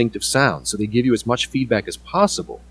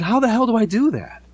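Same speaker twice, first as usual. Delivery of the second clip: exasperated disbelief; 12 semitones higher than usual. Retold or imitated. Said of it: "And she said".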